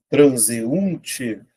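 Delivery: TRANSEUNTE drawn out as tran-se-un-te; syllable by syllable. Shown as a demonstration. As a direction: stretch each syllable of TRANSEUNTE. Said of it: "Vou separar".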